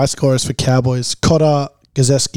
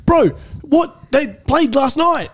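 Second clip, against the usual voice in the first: baffled retort; 9 semitones higher, slower than usual. Retold or imitated. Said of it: "I was like".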